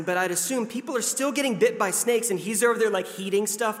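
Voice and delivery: ordinary delivery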